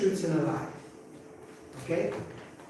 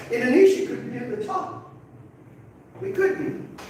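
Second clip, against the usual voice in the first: bright and much higher, a touch louder; excited; 13 semitones higher, 3 dB louder than usual. Real, not acted. Teacher to his class, excitedly picturing Indonesia on top. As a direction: sell the upside-down map with a thrill. Teacher flips the world map.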